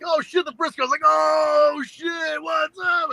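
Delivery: high excited shout of greeting. Retold or imitated. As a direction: yell it like a thrilled fan. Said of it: "And I was like".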